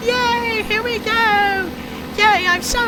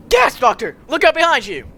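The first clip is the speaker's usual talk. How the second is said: low urgent warning; 4 semitones lower, 3 dB louder than usual. Acted. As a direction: lower voice calling a warning. Stranger shouts warning.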